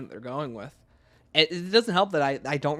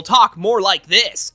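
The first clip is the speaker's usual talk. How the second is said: higher, much louder and slow, hammed-up tough talk; mock macho voice; 5 semitones higher, 12 dB louder, much slower than usual. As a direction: parody an actor forcing a macho tone. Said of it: "when they're trying to be more macho".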